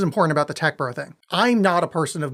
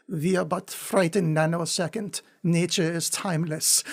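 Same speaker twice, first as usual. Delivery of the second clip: slow, lightly put-on German accent; a deadpan bleak German reading, slowed down; lightly done generic German accent, ponderous; 5 dB quieter than usual. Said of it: "so I'm just gonna do a generic German voice".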